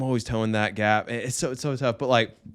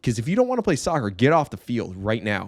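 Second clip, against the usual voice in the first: stern coach; 2 semitones higher than usual. Retold or imitated. Coach to goofing kids with parents watching, stern. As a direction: lay down the law to goofing players. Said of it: "I said it in that exact tone".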